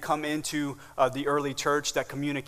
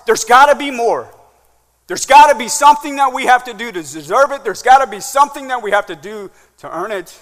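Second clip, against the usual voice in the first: higher, louder, insistent nagging; nagging inner voice; 8 semitones higher, 11 dB louder than usual. Acted. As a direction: press the nagging doubt hard. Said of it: "that whisper that continues to nag".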